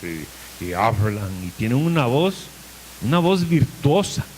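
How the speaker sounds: lightly put-on deep, manly preacher voice; a hint of a booming baritone preacher; mildly mock macho pulpit voice; testosterone preacher voice. Shown as a demonstration. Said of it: "que parece que tiene testosterona".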